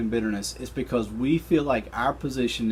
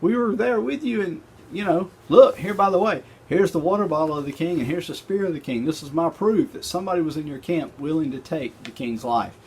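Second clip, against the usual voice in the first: higher, lively bragging voice; David taunting Abner from across the valley; cocky David; 7 semitones higher than usual. Retold or imitated. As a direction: show off the proof like a buddy. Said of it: "And David's like".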